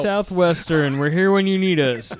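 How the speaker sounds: mock hospital ad. Host spoofing a hospital spot for his guest, mock ad read. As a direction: parody a hospital commercial voice-over.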